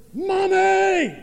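high, full-volume imitated kid yell; child's loud yell. Little boy demanding his mom's attention, hugely loud mock yell. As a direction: yell it like a child heard next door. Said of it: "in a voice that could have been heard in the Baptist church next door".

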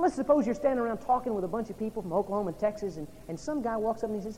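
ordinary talk, nothing marked

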